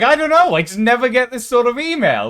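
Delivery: high, put-upon mock whine of the friend; whiny friend voice. Friend moaning to him that nobody collabs, high mock whine. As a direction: play him high and sorry for himself. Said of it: "And he's like".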